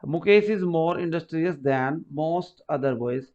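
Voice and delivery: slow, word by word example read; slow dictation. Shown as a demonstration. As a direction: read the sentence slowly so they can follow. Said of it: "तो यहां पे भी change कर सकता था". (धीमे-धीमे वाक्य पढ़ना)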